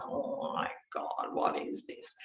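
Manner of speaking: slow, hushed, low "oh my God"; retold dread. Woman watching the huge blackness grow, hushed retold dread. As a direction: let the dread out on a soft, airless breath.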